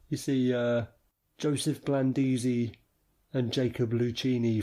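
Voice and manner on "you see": ordinary delivery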